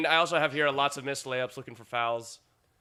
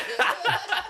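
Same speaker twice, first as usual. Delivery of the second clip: high laugh; 13 semitones higher than usual. Real, not acted. Reacting to joke accent.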